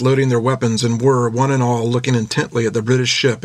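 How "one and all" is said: usual speaking voice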